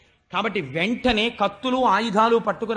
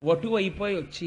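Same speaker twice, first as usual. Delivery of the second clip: normal talking voice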